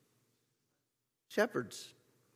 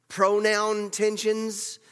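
slow, pronoun drawn out and louder; stress on pronoun; 5 semitones higher, 4 dB louder, much slower than usual. Real, not acted. Preacher listing tensions, pronoun as climax. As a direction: land pronoun tensions slowly and firmly.

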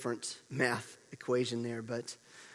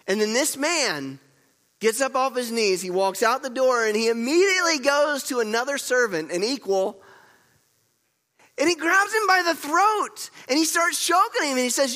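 loud, high, driving sermon storytelling; full-force preaching; 11 semitones higher, 10 dB louder than usual. Real, not acted. Preacher telling church how servant attacks his equal, full-force preaching.

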